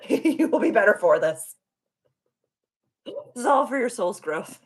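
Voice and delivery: high, mock-helpful guide voice; insistent spirit guides. Spirit guides telling her it is for soul's growth, insistent.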